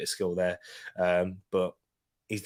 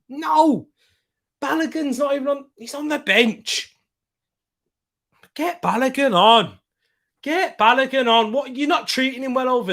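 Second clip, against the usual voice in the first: high-pitched protest; indignant outburst; 9 semitones higher than usual. Real, not acted. Protesting player left on bench.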